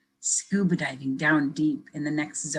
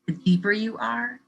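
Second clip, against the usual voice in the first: lively picture-book reading; reading to children. Acted. Teacher reading a picture book to kids, lively storytelling. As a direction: read it brightly for young listeners.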